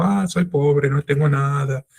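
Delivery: normal talking voice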